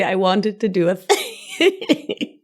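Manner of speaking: slow, giggling repeat of the phrase; laughing through it. Real, not acted. Host repeating her silly phrase, laughing slowly. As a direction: say it again while cracking up. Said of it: "What a silly thing to say".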